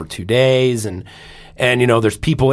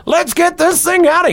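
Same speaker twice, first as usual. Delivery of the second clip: brisk doctor. Retold or imitated. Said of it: "and they're like".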